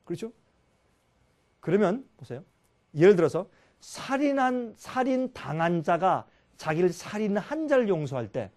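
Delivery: ordinary talk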